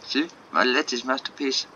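hammed-up amateur French accent. Artist explaining the borrowed bunny to Jacob, French accent. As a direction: play a defensive artist with a bad French accent. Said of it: "so many shit French accents".

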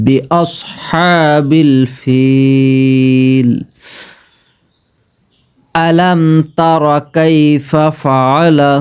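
বিআসহাবিল ফিল with a long drawn alif, slow. Shown as a demonstration. Teacher demonstrating madd, very slow stretched alif. Continued recitation.

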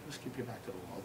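hushed warning. Retold or imitated. Friend warns him about wrecked Scottish guys, hushed. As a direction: warn a friend under your breath.

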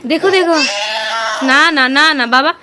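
calm him loudly while he fusses; mother loudly coaxing her crying son; loud soothing. Real, not acted. Mother soothing crying feverish child.

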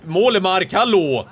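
loud, high scolding call; exasperated shouting. Real, not acted. Gamer scolding Wålemark for hiding behind Di Marco, exasperated. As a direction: call out sharply to a careless player. Scolding a player mid-match.

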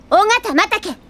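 high, ringing accusation; dramatic accusation. Acted. Detective naming the culprit to everyone, triumphant and high. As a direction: point at her and denounce.

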